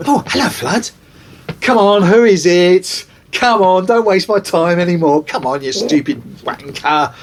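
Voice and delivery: telling off a supposed prank caller; mock exasperation. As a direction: brush off the prankster. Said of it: "I go".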